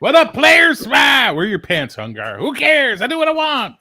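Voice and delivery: loud, high shouted party-guy entrance; Hungar impression. Friend mimics Hungar barging in, loud high shouting. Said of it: "That's Hungar".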